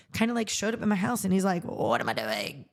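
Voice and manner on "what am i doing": George's low voice. Retold or imitated. Imitating George as he turns up at the house, low and easygoing. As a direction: lower the voice right down like George. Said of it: "That's kind of how he talks".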